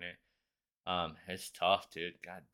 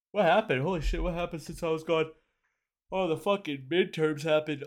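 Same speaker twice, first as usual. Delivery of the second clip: a loud, high burst of disbelief; higher and louder, hammed-up astonishment; mock shock; 8 semitones higher, 7 dB louder than usual. Real, not acted. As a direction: act floored by the news. Shocked by news on return.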